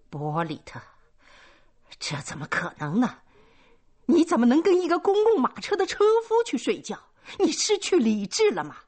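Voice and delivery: high, loud and fast outraged tirade; furious rant. A narrator's reading. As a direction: rant at her daughter in fury. 母亲痛骂女儿. (高声快速地怒骂女儿)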